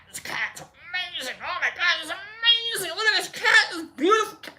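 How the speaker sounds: high, breathless-with-wonder marvelling; climbing squeal of awe over the cat; acted amazement. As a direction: gush in a tiny high falsetto. Awed at cat in retold trip.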